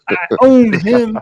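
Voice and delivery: high, loud and slow gloat; smug gloating. Retold or imitated. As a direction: drag it out like you just won. Mocking a smug tweeter.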